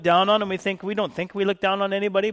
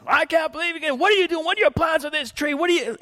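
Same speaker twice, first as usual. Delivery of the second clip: high-pitched mock indignation; outraged neighbor lady; 9 semitones higher than usual. Neighbor lady grilling Gary about the tree, high indignant voice. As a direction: play the scandalized lady, voice pitched up. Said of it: "She said it a few times".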